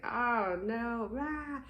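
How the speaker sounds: high playful oh no; mock dismay. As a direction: act out a comic slump. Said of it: "come back and be like".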